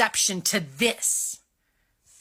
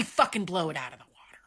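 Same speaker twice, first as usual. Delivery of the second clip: whispering; 5 semitones lower, 6 dB quieter, much faster than usual. Real, not acted. Ranting, drops to a whisper.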